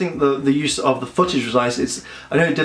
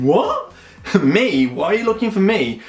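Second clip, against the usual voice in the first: jumps high on What, then disbelieving; hammed-up startled what me; mock surprise; 5 semitones higher than usual. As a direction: play it like he can't believe they want him. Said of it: "Jake's expression".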